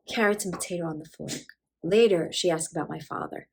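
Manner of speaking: plain reading voice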